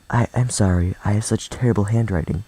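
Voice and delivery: ordinary delivery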